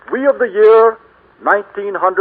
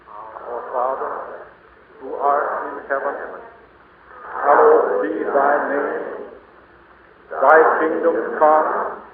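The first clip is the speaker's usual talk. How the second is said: solemn prayer; 2 semitones lower, 4 dB quieter than usual. Acted. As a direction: pray it softly and deliberately, as if to a congregation. Leads the Lord's Prayer.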